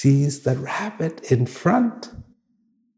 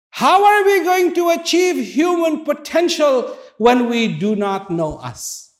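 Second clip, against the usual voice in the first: preacher challenging listeners, shouting; preaching shout; 11 semitones higher than usual. Real, not acted. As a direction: hammer the question at the congregation. Preacher drives home the point.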